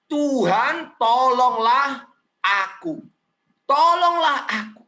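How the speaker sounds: drawn-out, beseeching cry for help; worshipful pleading. Acted. Woman kneeling before Jesus for help, pleading. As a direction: plead slowly, stretching each word. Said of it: "mendekat lalu dia menyembah".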